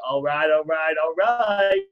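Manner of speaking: low, easy celebrity catchphrase, rising at the end; lightly imitated laid-back drawl; Matthew McConaughey. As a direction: imitate the catchphrase, starting low and lifting the last one. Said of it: "when he did Matthew McConaughey".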